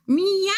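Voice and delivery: loud high cat meow; sudden mock meow at the cat. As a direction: call out a cat's meow. Imitating her cat's meow.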